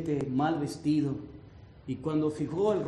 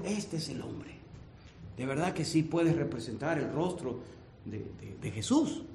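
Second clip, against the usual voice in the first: hushed painter; 3 semitones lower, 8 dB quieter than usual. Retold or imitated. Painter's hushed discovery quoted.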